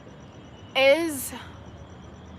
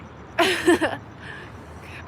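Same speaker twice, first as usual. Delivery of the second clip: high, breathy giggle; self-amused laugh; 6 semitones higher than usual. Real, not acted. Admitting she is like her mother, breathy high laugh. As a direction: giggle at yourself.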